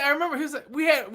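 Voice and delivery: ordinary talk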